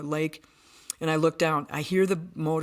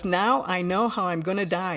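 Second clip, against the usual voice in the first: usual speaking voice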